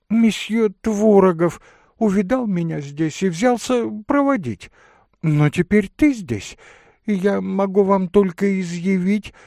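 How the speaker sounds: high, loud, agitated lady telling her mishap; voluble excitable lady. Acted. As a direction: pour out a dramatic excuse. (высокий взволнованный дамский голос)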